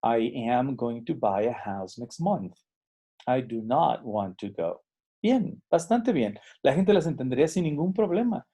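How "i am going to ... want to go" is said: slow, careful English with no Spanish accent; neutral English. Shown as a demonstration. Teacher demonstrates an upper-intermediate learner's neutral English. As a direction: say it clean and even, like an intermediate learner.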